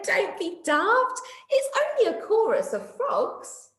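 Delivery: laughing at silly fears; mock laughing. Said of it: "Night Monkey laughed and said".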